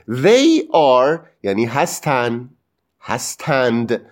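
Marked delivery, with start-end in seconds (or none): none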